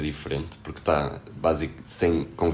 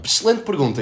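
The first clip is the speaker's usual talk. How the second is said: mock praise; 13 semitones higher, 6 dB louder than usual. Mock praise of own question.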